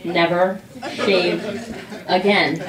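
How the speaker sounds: very slow, stretched sales pitch; dreamy ad-style emphasis; drawn-out emphasis. Acted. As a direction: drag out each word like an ad. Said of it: "how amazing would it be".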